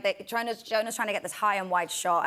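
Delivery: ordinary talk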